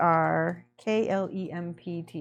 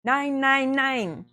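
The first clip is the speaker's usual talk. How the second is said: imitating a German tutor's objection, higher and louder; playful raised-pitch scolding; mock correcting tutor; 6 semitones higher, 5 dB louder, much slower than usual. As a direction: cut in like a teacher catching a mistake. Acting out language partner correcting her.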